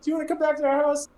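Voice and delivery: put-on high, fast, eager child voice; eager kids. Street kids inviting the adventurers home, high and fast. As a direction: rattle it off like excited kids.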